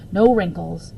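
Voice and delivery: ordinary delivery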